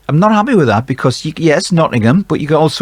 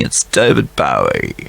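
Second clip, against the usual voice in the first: a hint of [name], much lower; bad Bowie impression; 12 semitones lower than usual. Quizmaster doing a half-hearted [name] voice. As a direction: drop low for a lazy Bowie voice. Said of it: "That is the worst Bowie accent".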